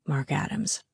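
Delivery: low, very soft murmur; whisper soft. A narrator's reading. Garrett names the gym teacher for Callie, whisper soft. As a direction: breathe the name into an ear. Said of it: "whisper soft".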